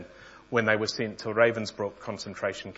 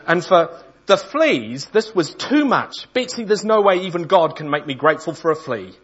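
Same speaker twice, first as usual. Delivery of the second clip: outraged protest; 7 semitones higher, 9 dB louder than usual. Acted. Corrie, refusing to give thanks for fleas, protests to Betsy high and loud.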